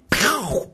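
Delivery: high "pow" impact noise; vocal sound effect of a big hit; imitated explosion. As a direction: mimic a blast going off.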